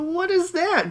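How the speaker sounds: high, shrill-free squeak of confusion; squeaky question. Pretend. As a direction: pop up high in mock puzzlement. Mock high-pitched question.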